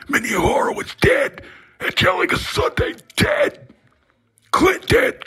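ordinary talk, nothing marked

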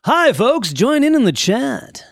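high, loud radio announcer voice. Shown as a demonstration. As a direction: play the cheesy radio host greeting listeners. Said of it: "That's my radio voice".

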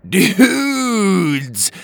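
loud, high happy call; excited greeting. Acted. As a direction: cheer it out to friends. Dallas greets Blobby excitedly.

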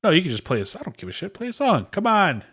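high, pushy urging; impatient insisting. Real, not acted. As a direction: nag him to just play something. Pushing friend to play music.

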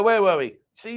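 usual speaking voice

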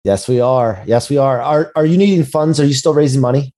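usual speaking voice

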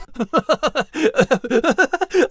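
high, giddy mouse chuckle; uncontrollable chuckle. Acted. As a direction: giggle helplessly. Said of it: "chuckle uncontrollably".

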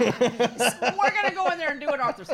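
loud, laughing doctor voice; mock doctor. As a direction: play a doctor lowballing it, laughing. Mock doctor promising small surgery.

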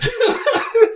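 short high cackle; real laugh breaking out; burst of laughter. Real, not acted. Vlogger cracking up at own joke, high laugh.

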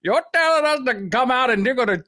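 a hammed-up panicky worker refusing to come out; mock scared workers. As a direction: play the frightened workers, fast at the end. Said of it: "They're like".

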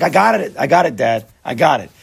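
loud, impatient mock protest; exasperated kid. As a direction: act out a child fed up with being told. Child fed up with parent.

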